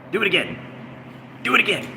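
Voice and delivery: high mocking do it again; taunting dare. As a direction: goad the troll, pitch rising. Taunting chat troll.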